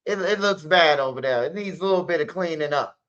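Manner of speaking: normal talking voice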